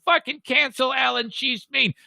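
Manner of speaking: mocking whine. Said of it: "all these assholes are saying".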